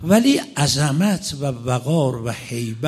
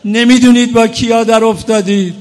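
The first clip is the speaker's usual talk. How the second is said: loud, higher, slowed wail of regret; old man's anguished cry; 8 semitones higher, 11 dB louder than usual. Retold or imitated. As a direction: cry out in horror at what was done. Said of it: "پیرمرد فریاد زد". (فریاد پرسوز پیرمرد)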